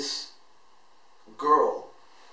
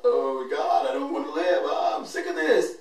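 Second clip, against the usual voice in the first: retold sobbing cry; 4 dB louder than usual. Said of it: "bawling my eyes out".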